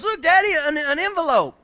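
put-on high, loud little boy's voice; a small son showing his daddy an envelope, high and excited; excited child. Son calling to his father, high excited child voice.

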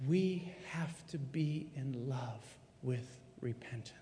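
hushed, intimate whisper. Real, not acted. Pastor whispering the point to the congregation, hushed. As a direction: lean in and whisper it.

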